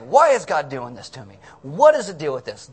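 loud mock complaint to God; hammed-up angry griping; mad. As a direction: raise your voice in acted indignation. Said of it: "we go home and we're mad".

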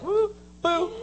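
high comic woo and boo; mock spooky sounds; playful imitated noises. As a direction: toss out two silly hoots. Imitates Jesus passing by.

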